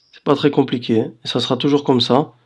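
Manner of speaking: ordinary talk, nothing marked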